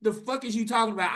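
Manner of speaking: high indignant rant; angry sibling chewing him out; exasperated rant. Retold or imitated. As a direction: pile on the indignation. Ranting at brother.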